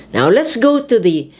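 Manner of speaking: ordinary talk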